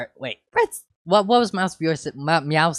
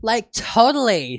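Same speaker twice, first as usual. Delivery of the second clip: put-on valley girl voice; 9 semitones higher, 7 dB louder than usual. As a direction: say it like a gushing teen. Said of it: "said the third voice".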